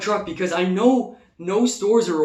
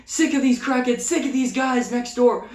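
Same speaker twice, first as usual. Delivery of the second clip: higher-pitched mock angry rant; retold cursing out; 7 semitones higher than usual. Cursing out the guy from next door, mildly acted anger. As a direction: act out a fed-up tirade. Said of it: "cursing this dude out".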